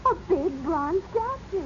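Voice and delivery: normal talking voice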